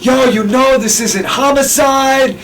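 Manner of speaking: high put-on voice, slow; fake prank-call voice. Teasing Gabe on the phone, high slow disguise. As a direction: stretch it out in a disguised voice. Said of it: "I'm like".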